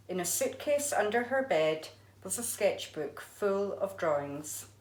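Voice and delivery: usual speaking voice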